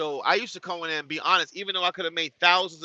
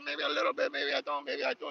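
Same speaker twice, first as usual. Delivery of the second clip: much higher, mock Spanish-accented reply; 12 semitones higher, 5 dB quieter than usual. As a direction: answer in a hammed-up Spanish accent, pitched high. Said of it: "I say".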